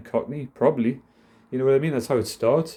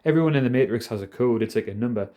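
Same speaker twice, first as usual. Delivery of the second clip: usual speaking voice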